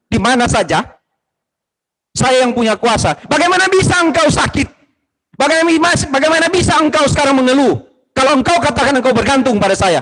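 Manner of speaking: high, loud commanding voice of God, sinking back at the end; booming rebuke as the Lord of all, calming at the close; God's voice. Retold or imitated. God rebuking a believer who only leans on him in trouble, lofty and loud. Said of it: "Tuhan bilang begini".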